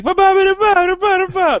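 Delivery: high, loud mock babble; hammed-up fake Italian gibberish. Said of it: "you can't just be like".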